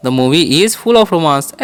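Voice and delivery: "The movie is full of romance" repeated slowly, stress on full. Shown as a demonstration. Teacher repeating the model sentence slowly. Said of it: "ऐसे बोल सकते हैं".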